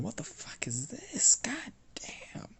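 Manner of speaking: hushed whispered swearing under the breath; muttered curse. Acted. Smith whispering curses at the wrecked shield, hushed.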